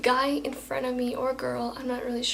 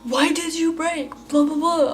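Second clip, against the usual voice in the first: mock yelling; 4 semitones higher, 7 dB louder than usual. Other driver blaming the speaker for braking, mock yelling. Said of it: "she starts yelling at me".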